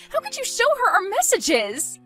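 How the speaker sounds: high-pitched upset reproach; distraught accusation. Acted. Bride to rival who showed the messages, distraught accusation.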